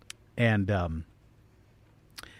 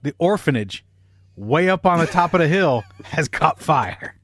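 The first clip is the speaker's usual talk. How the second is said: ordinary talk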